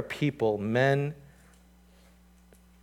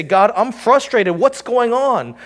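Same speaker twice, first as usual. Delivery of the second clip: mock frustrated cry; 9 semitones higher, 10 dB louder, slower than usual. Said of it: "they say".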